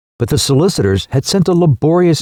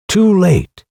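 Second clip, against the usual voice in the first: incredulous; 7 semitones higher than usual. Acted. Son echoes in disbelief.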